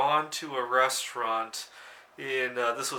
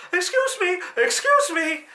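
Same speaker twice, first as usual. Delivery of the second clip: high, loud, exaggerated Japanese accent; 8 dB louder than usual. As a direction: call after someone in a thick Japanese accent. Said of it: "with an even more exaggerated Japanese accent going".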